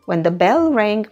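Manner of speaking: ordinary delivery, nothing marked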